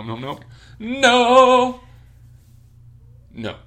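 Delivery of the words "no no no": very high, loud, slow no's; refusing the fan talk, loudly; emphatic refusal. Real, not acted. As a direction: drag out the refusal.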